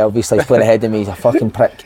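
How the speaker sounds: normal talking voice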